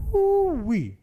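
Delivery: high, loud exclaimed woo-wee; excited whoop. Real, not acted. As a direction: whoop it out in delight. Exclaiming after a hit.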